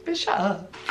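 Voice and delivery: exasperated, under-the-breath aside; acting out being fed up; mock irritation. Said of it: "irritated, so they just like".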